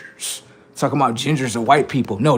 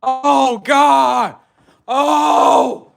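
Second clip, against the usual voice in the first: high panicked mock cry of fright, louder; hammed-up terrified gasp at a passerby; scared panic; 9 semitones higher, 4 dB louder, much slower than usual. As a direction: act spooked and ready to flee.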